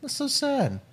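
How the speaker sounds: high, soft "aww" of pity; small high pitying voice; cooing sympathy. Acted. Reacts to actor's sad quote.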